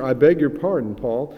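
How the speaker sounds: loud, lightly mock apology to Paul; a hint of the preacher realizing his mistake; old preacher apologizing. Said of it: "said the old preacher".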